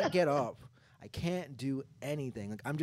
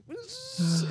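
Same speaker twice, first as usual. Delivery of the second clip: high, loud buzzing noise; imitated buzz; 8 dB louder than usual. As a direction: buzz like ringing ears. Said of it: "that, that buzz that comes in".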